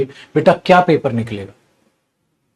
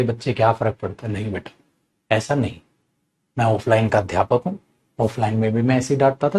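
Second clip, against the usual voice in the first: ordinary talk, nothing marked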